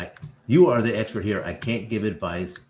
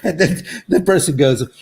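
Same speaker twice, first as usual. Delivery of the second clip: telling it through laughter, pitched up; laughing narration; 7 semitones higher, 7 dB louder than usual. Real, not acted. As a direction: keep laughing as you narrate.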